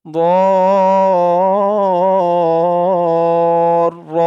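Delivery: দর held very long and loud on one tone; four-alif stretch. Shown as a demonstration. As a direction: draw দর out four counts, full voice. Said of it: "চার আলিফ টান দিয়ে". (দর চার আলিফ টেনে জোরে)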